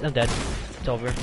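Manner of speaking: ordinary talk, nothing marked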